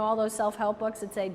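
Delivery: normal talking voice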